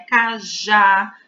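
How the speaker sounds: Cajá with a loud, strong final já; stress on JÁ. Shown as a demonstration. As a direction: punch the last syllable of Cajá. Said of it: "a sílaba forte é o já".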